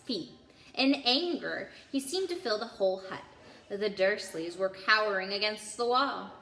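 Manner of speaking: ordinary delivery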